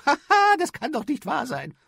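high, loud mocking laugh; lady laughing off the boy's claim. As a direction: laugh it off in disbelief. Noble lady laughs at Peter.

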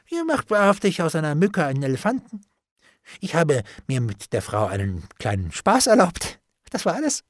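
affectedly breezy, jumping up at the end; feigned carelessness. A narrator's reading. Bailiff plays down his deal, with forced unconcern. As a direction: brush it off too lightly, pitch lifted. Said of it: "mit gekünstelter Sorglosigkeit".

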